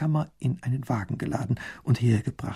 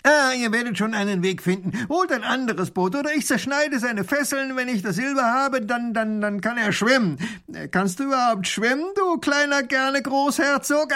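booming robber voice; 11 semitones higher, 3 dB louder than usual. Acted. Robber taunts the princess across the river, loud and mocking. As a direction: crow over the rich visitors with a big jeering laugh.

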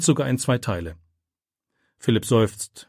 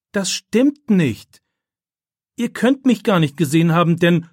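desperate protest; 7 semitones higher, 6 dB louder than usual. A narrator's reading. Accused boy protests loudly to the teacher and classmates. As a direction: plead your innocence hard.

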